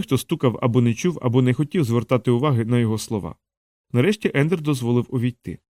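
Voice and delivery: ordinary delivery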